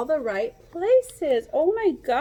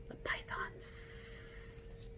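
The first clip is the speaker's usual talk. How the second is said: hushed, slow, creepy whisper; breathy snake-scare hush; spooky whisper; 21 dB quieter, much slower than usual. Acted. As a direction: whisper it like something dangerous.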